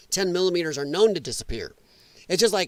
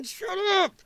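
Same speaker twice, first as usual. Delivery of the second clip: higher, mocking shut up; mock Democrat; 12 semitones higher than usual. Streamer mocking Democrats hushing each other, high mock voice. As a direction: squeal it like a panicked Democrat. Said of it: "Even the Democrats are going".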